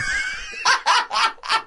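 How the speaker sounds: loud, very high burst of laughter; helpless piercing cackle; shrieking laugh. Real, not acted. Cracking up at baby remark.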